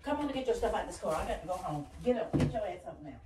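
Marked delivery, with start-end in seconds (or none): none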